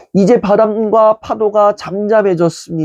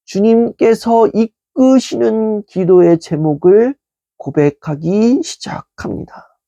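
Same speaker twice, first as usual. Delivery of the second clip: normal talking voice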